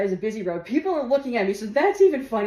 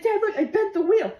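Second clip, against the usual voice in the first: higher, breathless explaining; high excited telling; 11 semitones higher than usual. Retold or imitated. Daughter telling dad, high and giggly. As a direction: blurt it out to dad.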